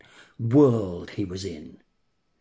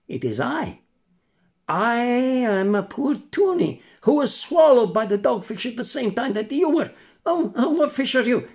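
high, far-off voice of a poor swallowed tunny; sad old tunny; 11 semitones higher, 4 dB louder than usual. Acted. Tunny introduces himself to the puppet, high and mournful. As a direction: play a mournful tunny fish.

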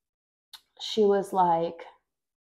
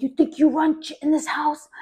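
angry mother; 7 semitones higher than usual. Retold or imitated. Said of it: "she got so pissed".

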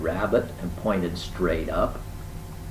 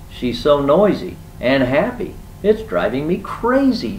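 high, loud complaint; acted fed-up outburst; exasperated; 7 semitones higher, 5 dB louder than usual. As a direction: protest as if at wit's end. Rabbit complains, driven crazy by noise.

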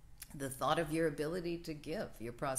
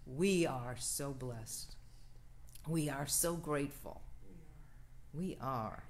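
prayer. Acted. Minister leading the congregation in the blessing, prayerful. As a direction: lead the room through it line by line.

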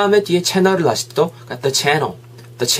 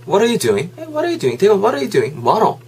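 casual, higher-pitched call to a friend; friend asking; 6 semitones higher, slower than usual. Acted. Asking a friend what they are doing, casual and higher. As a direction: act out a friend checking in. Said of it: "제가 친구한테 묻는 거".

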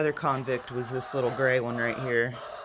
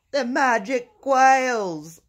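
slow amazed; 10 semitones higher, 7 dB louder, much slower than usual. Acted. Repeating the punchline, bigger.

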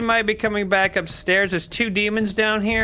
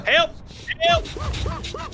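high panicked cries for help, higher; scared horror game streamer. Real, not acted. Caught by the monster.

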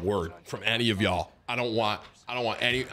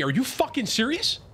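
high indignant outburst; 12 semitones higher than usual. Real, not acted. Furious at teammate's redeploy choice.

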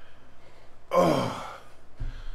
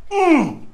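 pained whimper; 9 semitones higher than usual. Real, not acted. Eating very hot pepper.

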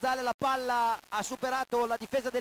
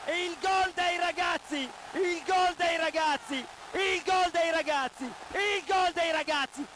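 ecstatic fan commentary; chanting a goal celebration; breathless italian goal commentary; 3 semitones higher than usual. Real, not acted.

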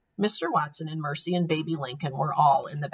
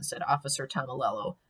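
ordinary delivery, nothing marked

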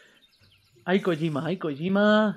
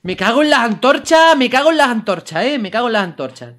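loud, raised outburst of annoyance; angry cursing; 4 semitones higher, 7 dB louder than usual. Real, not acted. Gamer cursing the torches, angry outburst. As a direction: curse the things loudly.

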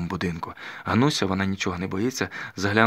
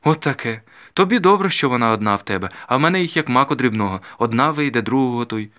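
1 worried mother complaining; 4 semitones higher, 7 dB louder than usual. A narrator's reading. Soldier's wife and mother lamenting.

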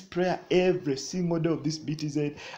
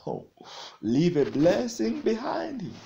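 normal talking voice

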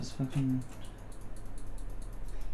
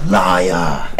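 angry accusation; 7 semitones lower, 5 dB louder than usual. Real, not acted. Yelling at the game.

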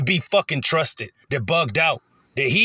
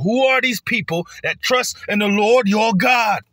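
cursing them out; 7 semitones higher than usual. Retold or imitated. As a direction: shout like a hostile enforcer demanding names. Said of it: "They gonna be cursing our people out".

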